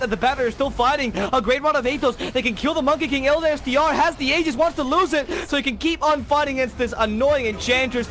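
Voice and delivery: high, loud excited casting; live play-by-play. Acted. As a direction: call every fight as it happens. Live Dota match casting.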